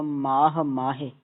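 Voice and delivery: ਮਾਹ ਮਾਹੇ slowly as "maa-ha maa-he"; slow model of ਮਾਹ with complete h; fully sounded h. Shown as a demonstration. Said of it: "ਪੂਰਾ ਪੜ੍ਹੋ".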